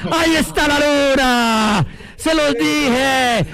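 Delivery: slow, stretched commentator's celebration call; triumphant cry. Real, not acted. Commentator as the moon finally appears, triumphant drawn-out cry. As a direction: draw out each phrase in triumph.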